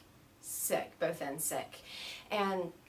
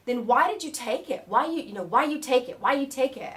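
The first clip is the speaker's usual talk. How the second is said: loud, raised, repeated challenge; exasperated yogi; 4 semitones higher, 10 dB louder than usual. Retold or imitated. Yogi questioning student about untaken curd, loud exasperation. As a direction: demand an explanation insistently.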